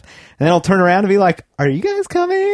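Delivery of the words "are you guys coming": high, voicing a happy dog. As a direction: talk as an eager puppy.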